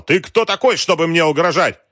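high, loud indignant challenge; outraged yelling. A narrator's reading. General answering Wolf's threat, yelling. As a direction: challenge him in a furious yell. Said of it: "заорал в ответ генерал".

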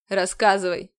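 low, loud stern order; stern demand. A narrator's reading. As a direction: demand it like a hard commander. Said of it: "сурово потребовал командор".

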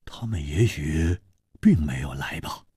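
low and soft, fading to a whisper; sombre, hushed worry; low grave voice. A narrator's reading. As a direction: say it heavily, under your breath. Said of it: "沉声说".

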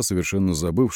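ordinary delivery, nothing marked

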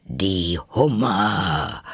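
slow, drawn-out cry; mild shout. Acted. Griffin leaping as it names the lobsters, mild shout. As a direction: cry it out in character, without full volume. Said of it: "schrie der Greif mit einem Luftsprunge".

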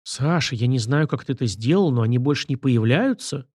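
plain reading voice

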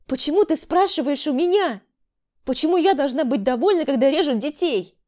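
indignant cry. A narrator's reading. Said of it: "С возмущением закричала Тамара". (возмущённый крик)

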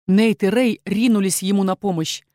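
usual speaking voice

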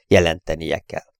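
ordinary delivery, nothing marked

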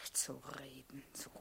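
hissed unvoiced whisper. Servant whispering back to Olga, barely audible. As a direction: breathe it out with no voice.

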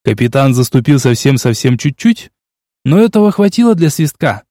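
ordinary delivery

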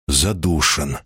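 usual speaking voice

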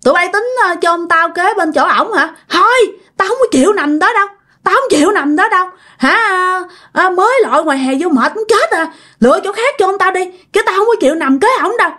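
put-on a possessed grandmother scolding her children; stubborn grandma. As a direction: protest the burial plan. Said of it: "Ý nói ông nội đó".

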